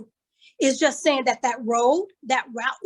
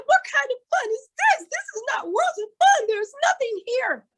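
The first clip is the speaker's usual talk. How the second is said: put-on crying child; 11 semitones higher than usual. Crying granddaughter complains.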